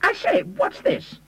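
very high, drawn-out cry of alarm; trapped man's high-pitched protest; shriek. A narrator's reading. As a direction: shriek it slowly, pitch shot right up. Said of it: "and shrieked".

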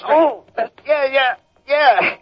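pained yelps. Acted. Arm twisted, gives in.

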